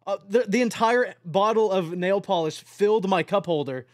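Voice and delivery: ordinary delivery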